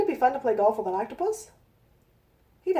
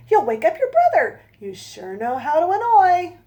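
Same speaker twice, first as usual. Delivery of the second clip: scolding mother; 7 semitones higher, 8 dB louder than usual. Acted.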